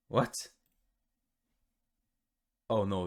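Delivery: normal talking voice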